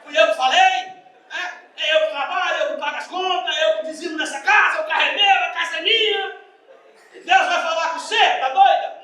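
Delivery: loud, high, indignant ranting; outraged macho husband. Retold or imitated. Preacher mocks a macho husband shouting at his wife, furious. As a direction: shout it like an offended head of house.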